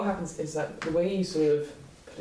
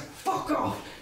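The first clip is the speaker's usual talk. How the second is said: defiant yell; 12 semitones higher than usual. Acted. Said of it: "I said".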